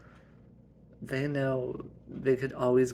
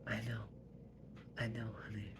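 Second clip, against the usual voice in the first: soft comfort; 11 dB quieter, faster than usual. Acted. Mom comforting daughter.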